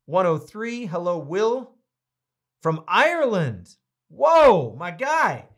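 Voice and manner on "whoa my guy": loud delighted whoa, then settling; light up at the news; excited whoa. Real, not acted. Pastor thrilled a viewer joins from Ireland, loud excited whoa.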